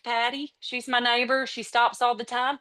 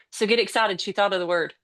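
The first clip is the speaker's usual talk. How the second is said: ordinary delivery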